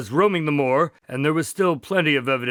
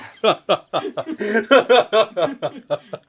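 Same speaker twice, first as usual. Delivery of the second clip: laughing hard; 5 semitones higher, 3 dB louder than usual. Real, not acted. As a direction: burst of real laughter at a flubbed line. Blooper laughter after flubbed line.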